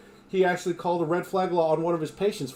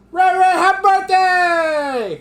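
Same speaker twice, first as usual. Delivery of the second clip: drawn-out, high, loud birthday call; loud birthday shout; 10 dB louder, slower than usual. Real, not acted. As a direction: belt the greeting out slowly across the room. Calling birthday greeting to viewer.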